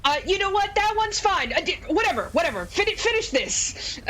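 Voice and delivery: high-pitched, exasperated dismissal; flustered giving in. Acted. Acted flustered character gives in.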